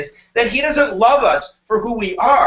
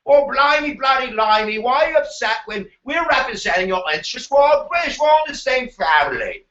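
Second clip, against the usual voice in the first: put-on British accent; 7 semitones higher than usual. Teacher mocking the British over representation in a British accent. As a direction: ham up a blustering British accent. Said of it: "they would go".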